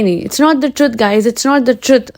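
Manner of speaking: ordinary talk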